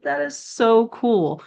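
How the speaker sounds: high and slow, delighted; excited. Retold or imitated. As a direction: savor it with wonder. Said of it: "And I was like".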